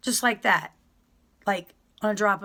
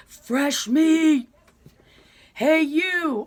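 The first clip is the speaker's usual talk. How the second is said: raised, higher catcall to fresh arrivals; inmates heckling newcomers; 5 semitones higher, 4 dB louder, much slower than usual. Retold or imitated. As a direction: yell it across the yard at the new girls. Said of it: "all you start to hear".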